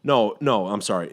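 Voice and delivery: normal talking voice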